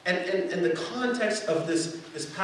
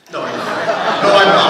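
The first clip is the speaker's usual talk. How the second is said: laughing correction, much louder; 3 semitones higher, 14 dB louder than usual. Real, not acted. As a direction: laugh it off loudly at your own joke. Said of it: "'cause I usually am".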